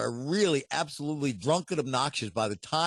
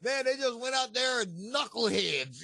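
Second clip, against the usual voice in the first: put-on higher, shouted grumpy old-timer; 9 semitones higher, 8 dB louder, slower than usual. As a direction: gripe like an old football veteran. Old-timer griping about football.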